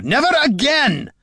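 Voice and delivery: high-pitched outburst, cutting in; sudden acted cry of refusal; shout. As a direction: shout it as an impassioned interruption. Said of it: "shouted Gorm".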